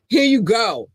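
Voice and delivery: showing off the new notes; excited presenting. Real, not acted. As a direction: present it like a gift. Unveiling the new love notes.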